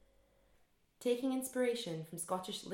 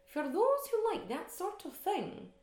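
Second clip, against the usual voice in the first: put-on high, slow, prim Edinburgh accent; Miss Brodie's Edinburgh voice; 12 semitones higher, 4 dB louder, slower than usual. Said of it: "said Miss Brodie in her best Edinburgh voice".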